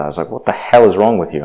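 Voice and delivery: ordinary talk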